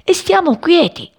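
high, insistent character voice; urging mother-bird figure. A narrator's reading. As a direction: urge them inside in a high voice. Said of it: "gli raccomandò Passerì".

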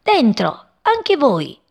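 high, bright playful order; light command. Acted. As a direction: cheerfully order the chicks in. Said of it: "E comandò". (ordine allegro e acuto)

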